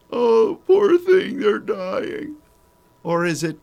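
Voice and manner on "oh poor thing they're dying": squealed high, slow and loud, hammed-up pity; mock pity. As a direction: whine like a sappy mourner.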